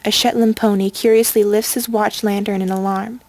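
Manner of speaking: plain reading voice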